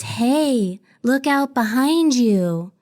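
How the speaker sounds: put-on slow, eerie singsong child voice; little girl's voice. Passenger retells eerie voice heard while boarding, little girl voice. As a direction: draw it out like a creepy little girl. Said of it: "I hear this little girl's voice".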